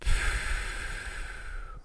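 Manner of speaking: slow, soft releasing sigh; long exhale. Shown as a demonstration. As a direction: let the pressure out in one breath. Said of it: "let somebody emotionally exhale".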